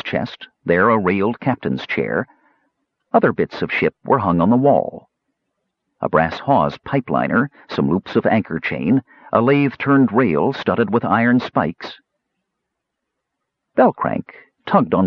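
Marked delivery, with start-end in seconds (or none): none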